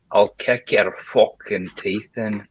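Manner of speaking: slow, menacing Scottish accent; violent Scottish accent. Shown as a demonstration. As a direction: threaten slowly in a put-on Scottish accent. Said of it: "Scottish accent is, it only works if you say something".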